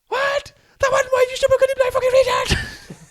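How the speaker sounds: very high, loud raging scream; a player screaming abuse at the referee, very high and loud; furious abuse. Retold or imitated. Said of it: "abuse that I've ever heard a referee get".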